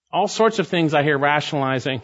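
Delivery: ordinary talk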